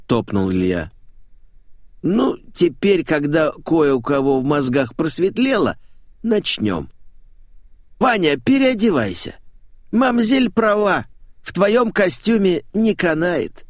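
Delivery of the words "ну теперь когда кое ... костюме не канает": flamboyant showman, bossy. Acted. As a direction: direct everyone in a high, campy voice.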